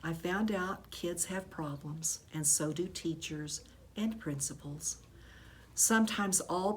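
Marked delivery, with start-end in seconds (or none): none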